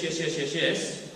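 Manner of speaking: ordinary talk, nothing marked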